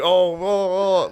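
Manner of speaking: slow, high mock taunt; joking taunt. Getting in Wood's face, a slow joking taunt. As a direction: goad him playfully. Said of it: "like, joking".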